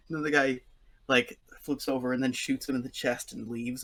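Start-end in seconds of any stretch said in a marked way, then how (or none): none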